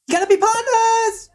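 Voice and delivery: piercing high comic squeal; hammed-up screechy pleading; Chris Tucker. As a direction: squeak it out, insisting wildly. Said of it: "Maybe Chris Tucker could be the".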